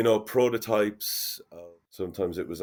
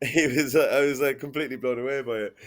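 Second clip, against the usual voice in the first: higher, louder, laughing as you tell it; real amused laughter; 6 semitones higher, 3 dB louder than usual. Real, not acted. Guest telling host of the big reveal, laughing.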